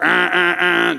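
short run of even air-horn blasts; air horn honks. Retold or imitated. Camp counselor demonstrating the dinner signal, imitated air horn. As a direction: imitate a repeated air horn on one note. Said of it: "They get out the air horn".

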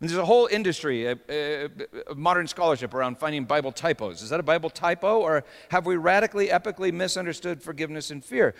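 ordinary talk, nothing marked

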